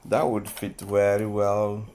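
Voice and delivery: ordinary talk, nothing marked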